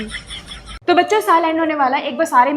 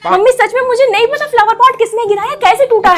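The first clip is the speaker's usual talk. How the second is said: pleading protest; 6 semitones higher, 5 dB louder than usual. Acted. Daughter protesting to mom.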